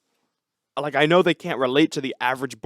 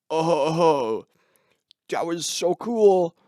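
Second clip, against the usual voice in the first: slow, drawn-out dopey musician; mock dimwit voice; 2 semitones higher, much slower than usual. Dim musician handed a coffee, slow put-on dopey awe. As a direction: play him dumb, dragging every word. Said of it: "got the I Q of, like, a vegetable".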